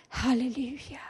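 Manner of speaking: breathy whispered praise; soft whispered amen-like praise; whispered halleluja. Real, not acted. Whispered praise after reading.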